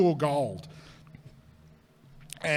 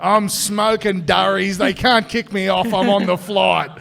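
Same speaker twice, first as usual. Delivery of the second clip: put-on drunk bragging; 3 semitones higher, 9 dB louder than usual. Drunk brag on the plane.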